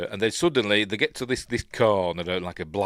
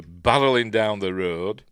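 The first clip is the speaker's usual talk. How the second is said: usual speaking voice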